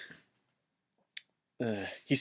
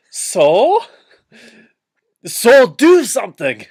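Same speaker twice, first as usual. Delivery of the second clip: higher, louder, slowed frantic demand; hammed-up yelling Merry; 8 semitones higher, 9 dB louder, slower than usual. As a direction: yell it like a frantic cartoon hobbit. Merry yells, voiced.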